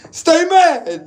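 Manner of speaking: high teasing jab; mock the haters, sing-song high; taunting. Real, not acted. Vlogger taunting people who are mad at him.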